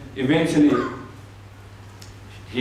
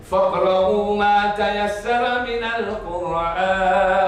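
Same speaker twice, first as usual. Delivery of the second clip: quick, higher melodic chant; Quran recitation; 7 semitones higher, much faster than usual. Acted. Woman asking him to recite, chanted. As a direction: sing the verse out briskly.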